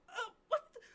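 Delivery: high, loud shocked stammer; startled gasping. Acted. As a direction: gasp and recoil mid-question. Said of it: "she's kinda just like".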